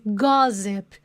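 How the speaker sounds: "Gossip" with open first vowel and a Z sound; pronunciation model. Shown as a demonstration. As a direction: say "Gossip" as GAH-zep. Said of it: "olha a pronúncia". ("Gossip" com som de Z e O aberto)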